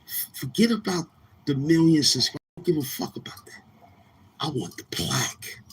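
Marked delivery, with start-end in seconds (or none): 2.6-5.6 whispered aside. Real, not acted. Aside about not caring for subscribers.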